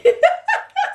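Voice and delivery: shrill squealing laugh; high burst of laughter. Real, not acted. Reacting to mom's guess.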